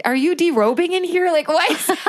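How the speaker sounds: high-pitched mock disbelief; incredulous. Partner walks in on her undressing in pain, incredulous. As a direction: imitate a baffled partner, voice shooting up. Retells partner's shocked question.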